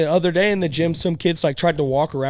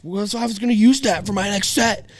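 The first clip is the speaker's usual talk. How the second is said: retold screaming; 8 semitones higher than usual. Gym guy yelling at him over a plate, mock shout. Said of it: "dude screaming".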